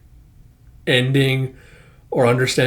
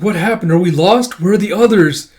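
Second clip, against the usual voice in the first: higher, louder voice for a lost character; acting out worried questions; mock-panicked character voice, pitched up; alarmed questioning; 6 semitones higher, 6 dB louder than usual. Voicing a lost, confused character.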